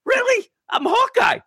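high, indignant squeal; mock offended squeal. Hawkeye offended at being outranked by Stingray, high indignant squeal. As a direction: squeal it like a hurt ego. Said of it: "He's like".

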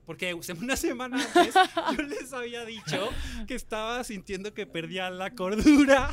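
loud, high, laughing delivery; laughing while talking. Real, not acted. Storyteller admitting he warned of losing sanity, laughing. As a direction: crack up as you confess it. Laughs telling his earlier warning.

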